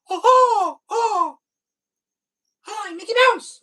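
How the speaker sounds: very high, loud falsetto mouse voice; strained, cracking falsetto Mickey. Shown as a demonstration. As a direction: push up into a squeaky falsetto. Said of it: "I can't get that high".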